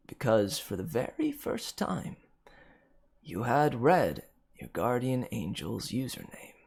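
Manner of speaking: ordinary delivery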